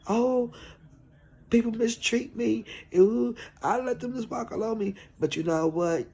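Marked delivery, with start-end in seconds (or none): 0.1-4.9 high-pitched, imitated weepy lament; mock crying complaint. Woman quoting herself crying over being mistreated, high imitated whine. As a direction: act out your own tearful complaint, pitched well up. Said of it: "I cry and say".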